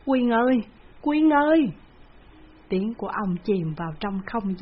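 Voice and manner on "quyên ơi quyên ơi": high, loud call into the distance. A narrator's reading. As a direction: call for the lost daughter, rising. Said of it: "vừa đi vừa cất tiếng gọi". (gọi to, cao giọng, tha thiết)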